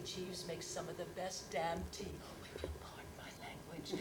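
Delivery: hushed whisper over a naughty word; confiding whisper. Acted. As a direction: whisper it as a guilty aside. Aside about swearing.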